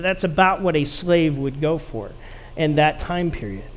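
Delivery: usual speaking voice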